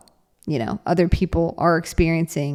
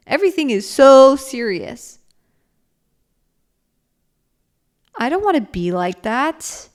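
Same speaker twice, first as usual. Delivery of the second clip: dawning realization; 6 semitones higher than usual. Retold or imitated. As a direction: ask it as if it just hit you. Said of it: "you realize".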